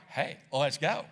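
casual friendly call; inviting. Retold or imitated. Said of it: "more like".

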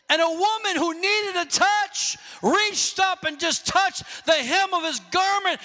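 usual speaking voice

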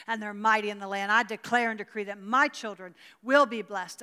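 put-on loud, fervent prayer of decree over her children; full-voiced intercession; declaring prayer. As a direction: proclaim it like a bold decree before God. Praying aloud in declaration.

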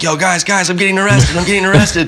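high, urgent panic; panicked. Retold or imitated. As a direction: blurt it out in alarm. Panicked call to teammates.

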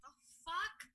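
mock meltdown. Cohost dreading the retrograde shadow, hammed-up mock meltdown. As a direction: play up exasperated despair. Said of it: "I've had my moment".

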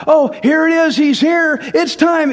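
high, loud and thrilled; excited shepherds. Retold or imitated. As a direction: burst out like shepherds hearing the news. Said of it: "Can you imagine how that hit them?".

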